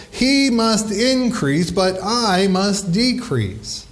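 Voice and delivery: slow, stressing He and I; slow emphatic quote. Acted. Preacher giving the congregation the theme verse, slow and emphatic. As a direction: lay the verse down word by word.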